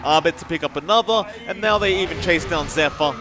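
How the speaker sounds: excited play-by-play. Real, not acted. Esports caster following the chase after a fight, excited call. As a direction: keep the energy up, punching each play.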